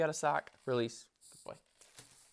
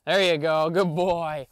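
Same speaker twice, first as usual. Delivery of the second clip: loud puppy praise; 3 semitones higher, 12 dB louder, slower than usual. Real, not acted.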